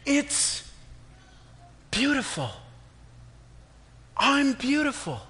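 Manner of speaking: high, slow wonder; amazed. Acted. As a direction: marvel at your own reflection. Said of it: "I said".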